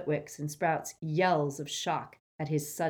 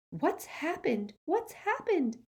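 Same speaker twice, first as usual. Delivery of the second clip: slow, much higher, airy; Luna airy; 12 semitones higher, slower than usual. Acted. Luna asking, airy and high. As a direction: drift it out dreamily.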